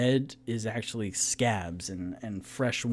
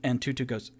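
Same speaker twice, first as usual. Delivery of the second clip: ordinary delivery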